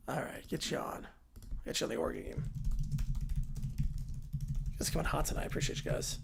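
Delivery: whispered thanks to chat; soft sign-off. Real, not acted. Streamer quietly thanking viewers, whispered. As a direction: drop to a whisper.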